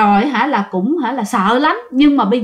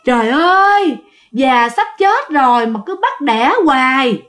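sobbing moan. Retold or imitated. The sow moans to itself in a sobbing wail. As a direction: wail like a tired old sow. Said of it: "cái tiếng nói là nức nở, rên rỉ lắm".

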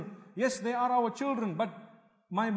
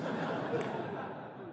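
breathy laugh; 13 semitones higher, 10 dB quieter than usual. Real, not acted. Laughing at own joke.

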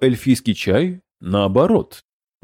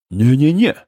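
frightened. A narrator's reading. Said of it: "испугался сраный дракон".

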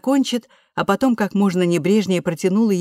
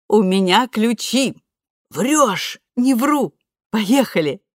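excited; 5 semitones higher, 4 dB louder, slower than usual. A narrator's reading. Excited back-and-forth.

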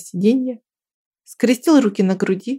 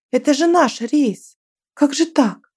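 dismayed exclamation; 6 semitones higher, slower than usual. Acted. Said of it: "Nastya upset at flight delay".

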